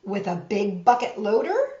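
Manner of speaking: storybook reading voice; reading to children. A narrator's reading. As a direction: read it like a bedtime picture book. Reads picture book to children.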